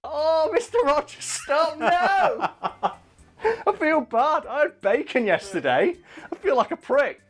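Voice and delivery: high-pitched, squealing gush with laughter; overwhelmed fan gushing about Mister Rogers with a laugh. Real, not acted. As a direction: squeal like you can't take how sweet he is.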